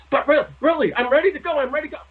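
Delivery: put-on high, eager kid pleading; impatient kid begging to go. Host imitating eager Joe, high and pleading.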